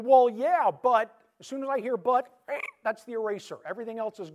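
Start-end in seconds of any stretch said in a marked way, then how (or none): 0.0-2.3 high, loud mock defensive "Well, yeah, but". Pastor mocking the defensive reply to criticism, loud and high. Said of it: "not going".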